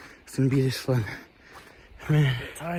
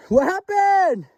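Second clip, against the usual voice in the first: shouted question; 6 dB louder, much slower than usual. Real, not acted. Calling to the others.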